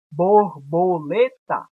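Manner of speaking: "borboleta" slowly as bor, bo, le, ta; spaced-out "borboleta", each syllable distinct; slow, measured beats through "borboleta". Shown as a demonstration. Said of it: "que é a sílaba mais forte".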